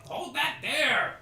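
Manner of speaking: high, loud, drawn-out work-site shout; foreman yelling. Acted. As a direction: bellow an order across the construction site. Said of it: "people yelling".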